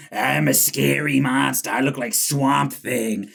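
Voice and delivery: slow, drawn-out hammy monster voice; mock a monster trying hard to scare; mocking Vecna. Podcaster mocking Vecna to co-host, hammed-up monster. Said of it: "and he's like".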